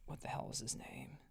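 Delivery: muttered aside. Real, not acted. Reviewer forgetting a character's name.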